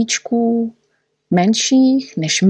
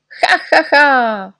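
triumphant laugh; 7 semitones higher, 4 dB louder, slower than usual. A narrator's reading. Pleased laugh.

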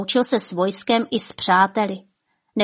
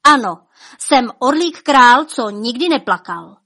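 king's higher, louder proud cry; shouted defiance; 4 semitones higher, 6 dB louder than usual. Acted. King shouting his name at Death, defiant. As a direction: fling the words at Death. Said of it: "vykřikl panovník".

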